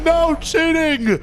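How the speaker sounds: high, drawn-out reprimand; yelling teacher. Retold or imitated. Strict teacher catching students cheating, mock yelling. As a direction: snap it high and stretched, mock furious. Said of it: "Bro just yells the whole time".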